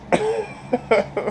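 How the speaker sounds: squeaky high laugh. Real, not acted. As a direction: burst into a high giggle. Laughing after rant.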